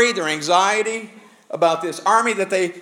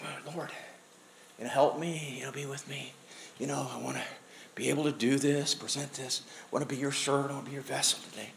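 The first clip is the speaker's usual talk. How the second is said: much softer, hushed prayer to the Lord; quiet retold prayer; 3 semitones lower, 12 dB quieter, faster than usual. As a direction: murmur a sick man's plea for help. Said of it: "congestion has moved down into my chest".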